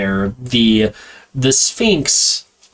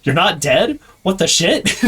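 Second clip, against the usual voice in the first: shocked hunters; 6 semitones higher than usual. Retold or imitated. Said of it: "and they're like".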